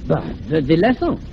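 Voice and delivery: hesitant fake French accent; confused put-on Frenchman. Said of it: "talking like this with a French accent".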